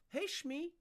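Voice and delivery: high-pitched greeting; high call. Real, not acted. Calling out high.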